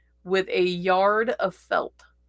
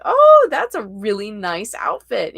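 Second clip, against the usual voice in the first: gushing compliment; 8 dB louder than usual. Retold or imitated. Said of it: "Somebody's walked past you and like".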